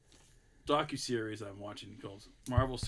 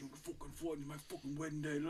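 imitating Irish English accent; 11 dB quieter than usual. Retelling the mobster briefing his buddies, quieter Irish English accent. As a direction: mutter the rule in a light Irish English accent. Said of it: "Joe doing an Irish English accent".

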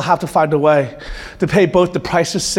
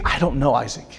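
hushed tender admission; 5 semitones lower, 6 dB quieter than usual. Retold or imitated. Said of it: "He's saying".

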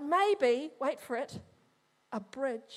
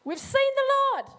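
excited announcement; 11 semitones higher, 7 dB louder than usual. Acted. Disciples' claim replayed, louder.

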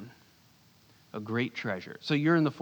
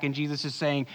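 ordinary delivery

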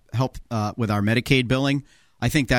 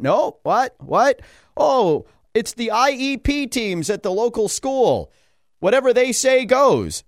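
mock bewildered official; 10 semitones higher, 3 dB louder, slower than usual. Officials passing the buck.

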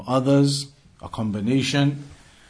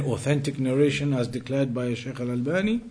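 ordinary delivery

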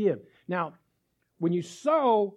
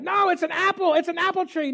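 higher, louder mock insistence; husband arguing with his wife, raised voice; insistent protest; 10 semitones higher, 5 dB louder than usual. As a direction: defend the stick as a tree.

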